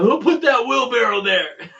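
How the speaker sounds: laughing mock outrage. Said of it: "what I would have been saying".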